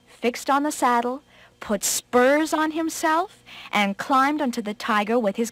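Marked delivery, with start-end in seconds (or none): none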